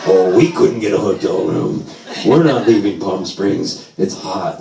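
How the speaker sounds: put-on slow, low elderly grumble; complaining. Old parents griping about hotels and heat, slow complaint. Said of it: "And they were complaining".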